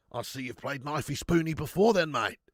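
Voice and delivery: broad Australian film-quote delivery; Aussie impression. Streamer quoting Crocodile Dundee, mock Australian accent. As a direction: put on a jokey Aussie accent. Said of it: "Do an Aussie impression?".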